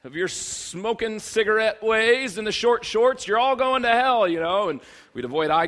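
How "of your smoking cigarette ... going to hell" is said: loud, high mock fire-and-brimstone yell; yelling street preacher. Mimicking a man on a box yelling at students, loud preacher yell. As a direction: imitate a campus preacher hollering condemnation. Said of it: "would yell at us to repent".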